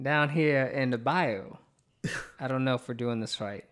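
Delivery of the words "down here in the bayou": slow put-on Savannah accent; amateur Savannah accent, unhurried. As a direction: ease into a Savannah Southern accent. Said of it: "The Savannah accent".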